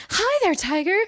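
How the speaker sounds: high, playful greeting in imitation; Mary Jane. Revived Jean greeting Scott, playfully flirty. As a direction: greet him like a flirty comic-book sweetheart. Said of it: "Very Mary Jane-like".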